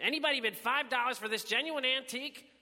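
high-pitched, impatient appeal rising at the end; impatient auctioneer. A narrator's reading. Willie offering the antique to silent bidders, impatient high call. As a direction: plead for any bid at all. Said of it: "Willie sounded impatient".

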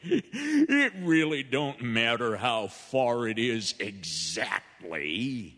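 laughs through the words, slow and easy; chuckling at the old man, unhurried; slow amused drawl with a laugh in it; laughing salesman. Acted. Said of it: "And the salesman laughed".

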